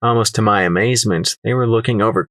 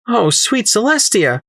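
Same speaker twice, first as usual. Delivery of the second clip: higher-pitched gasp of disbelief; shocked exclamation; 10 semitones higher than usual. Acted. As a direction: blurt it out, astonished. Startled exclamation on seeing sketchbook.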